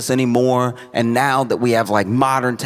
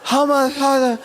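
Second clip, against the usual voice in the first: whining hiker; whiny complaining; 11 semitones higher than usual. Retold or imitated.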